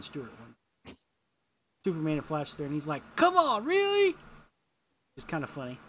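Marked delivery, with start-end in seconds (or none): 3.1-4.2 high, loud mock exasperation; incredulous complaint. Retelling a superhero balking at a crowded bank job, incredulous. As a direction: voice jumping up in hammed-up disbelief.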